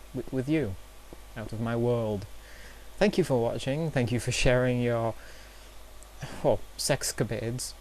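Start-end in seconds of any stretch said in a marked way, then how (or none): none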